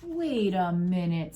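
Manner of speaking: slow, loud, stretched-out words; drawn-out storybook disbelief; suspicious policeman. Acted. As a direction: halt someone with dawning suspicion.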